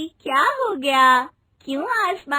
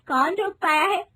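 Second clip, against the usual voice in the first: soft giggles between words; giggling; 3 semitones higher, 3 dB quieter than usual. Acted. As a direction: laugh inappropriately at a memorial. Rinki giggles at memorial.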